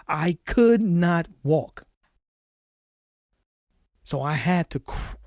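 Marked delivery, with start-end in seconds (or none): 0.1-1.8 "I could not walk" slow and loud, each word separated; emphatic stress. Real, not acted. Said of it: "I want you to understand how bad this is".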